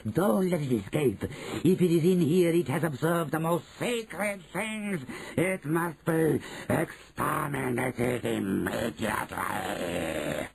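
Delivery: put-on high accented command, slowing to a long finish; screeching witch leader. Grand High Witch condemns the intruder, drawn-out menace. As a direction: command it high, stretching the final word. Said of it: "screeched the Grand High Witch".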